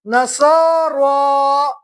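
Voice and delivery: نسا را stretched very slowly; very slow joined reading. Shown as a demonstration. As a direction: draw out نسا را for the class. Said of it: "را کھڑا زبر را".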